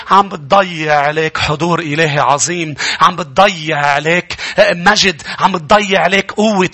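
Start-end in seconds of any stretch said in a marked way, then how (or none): none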